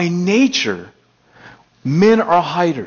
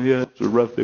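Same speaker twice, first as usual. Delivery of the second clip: lower, softer plain-spoken British mate; honest Brit; 5 semitones lower, 4 dB quieter, faster than usual. Retold or imitated. Preacher imitates a candid British person, lower and softer. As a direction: admit it's a bad day, no front. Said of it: "That's how the British are".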